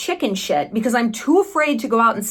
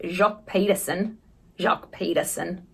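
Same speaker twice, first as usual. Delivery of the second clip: quieter, careful Australian-accented name; attempted Australian accent; 3 semitones lower, 6 dB quieter than usual. Shown as a demonstration. Vlogger naming podcast host, attempting Australian accent. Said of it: "I'm trying to get it".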